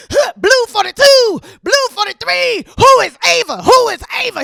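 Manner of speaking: high, loud quarterback-style shouting; football play call shout. Retold or imitated. Shouting play calls at the boyfriend choking her, loud football yell. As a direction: bark out the play call like a quarterback under attack.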